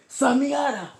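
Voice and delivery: high, loud shouted attack name; battle cry. Acted. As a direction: yell it out like an anime attack. Acted attack cry in retold fight.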